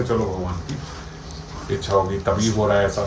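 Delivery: slow, soft, resigned acceptance; a weary, quiet surrender to fate; resigned sigh. Retold or imitated. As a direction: let it sink in with a sigh. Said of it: "हम समझते".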